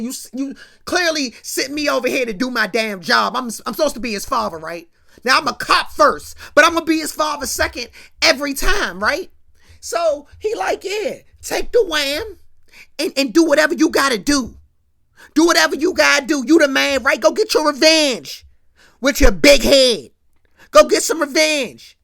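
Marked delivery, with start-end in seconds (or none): 0.0-9.3 loud, fast angry justification; heated rant. Retold or imitated. JC rants at Chantel. 10.8-22.0 loud, higher sarcastic dare; mocking taunt.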